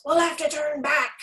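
pirate calling out. A narrator's reading. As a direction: call across the deck. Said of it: "called another".

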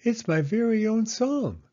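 put-on high, eager child voice; a small girl begging her mother to listen; little girl Penny. Little Penny asks Mama to listen, high and eager.

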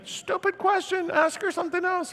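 high-pitched, exasperated scolding; mock yelling. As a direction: scold a friend in a panic. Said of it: "[name]'s yelling at me".

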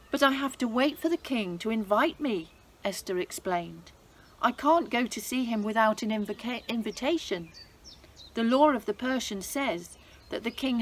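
lively, expressive storybook reading; story narration for children. A narrator's reading. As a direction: read it like a bedtime Bible story for kids. Reads Bible story to children.

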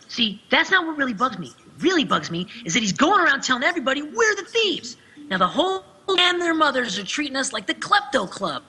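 youthful, fired-up cartoon teen; Hayner line read. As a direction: read it as an indignant teenage boy. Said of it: "wanna hear me say some of these".

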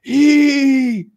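high, loud ape blurting it out; a dim ape spilling the secret. Retold or imitated. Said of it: "the monkey goes".

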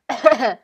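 loud theatrical cough; loud fake cough. Spirit interrupting the prophecy, hammed-up cough. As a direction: hack it out dramatically.

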